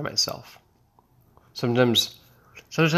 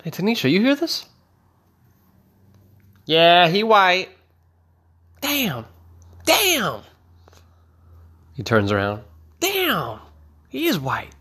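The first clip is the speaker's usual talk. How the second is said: security guard; 8 semitones higher than usual. Acted. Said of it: "this is what he looked like".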